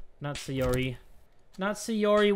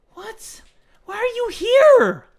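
high, loud startled cry; shocked; 13 semitones higher, 7 dB louder, slower than usual. Real, not acted. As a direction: blurt it in shock. Enemy appears again.

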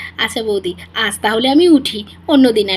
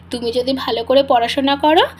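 ordinary delivery

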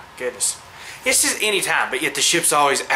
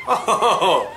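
laughing; 7 semitones higher, 6 dB louder than usual. Real, not acted. Laughing at weird creature.

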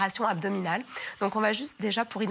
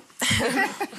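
bright laugh; 6 semitones higher, 5 dB louder than usual. Real, not acted. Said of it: "J'ai mal fait".

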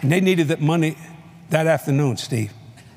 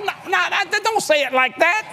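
playful pleading; 11 semitones higher than usual. Retold or imitated. Teasing wife, acting out desire.

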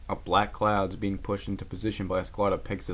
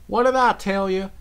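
much higher, louder whine; moaning; 12 semitones higher, 8 dB louder than usual. Acted. Rarity moans after the falling rocks. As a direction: moan in dismay. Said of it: "Rarity moaned".

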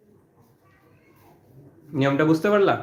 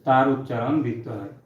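slow, lower dictation pace with long gaps; 3 semitones lower, 4 dB quieter than usual. Acted. Teacher dictates the second rule to students in a slow dictation voice. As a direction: read the rule out slowly so the class can write.

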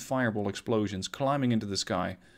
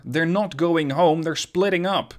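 urgent alarm; 5 semitones higher, 6 dB louder than usual. Acted. Sonar operator bursts in alarmed.

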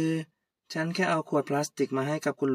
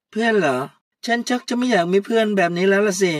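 louder and higher contemptuous retort; scornful rejection; 4 semitones higher, 7 dB louder than usual. Acted. Puy disowning Kaew as a friend, louder and higher. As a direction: sneer off the friendship.

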